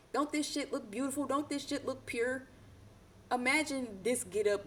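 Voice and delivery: admiring. Real, not acted. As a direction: gush over how pretty it is. Admiring the card's beauty.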